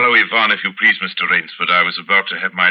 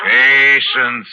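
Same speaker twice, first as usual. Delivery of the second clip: sharp command; 5 semitones higher, 6 dB louder than usual. Acted. Zaroff calms his hungry falcon.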